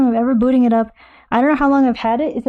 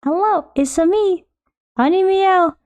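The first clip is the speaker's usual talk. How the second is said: sing-song hello; 6 semitones higher, slower than usual. Pretend. Sing-song greeting to newcomer.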